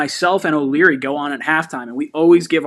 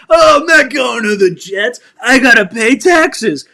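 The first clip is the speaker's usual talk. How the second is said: mocking impression; 6 semitones higher, 6 dB louder than usual. Mocking rival player's excuse.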